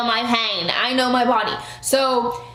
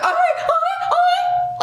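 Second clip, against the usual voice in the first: much higher, laughing placation. Retold or imitated. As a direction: laugh it off, up high. Retold backing down, laughing.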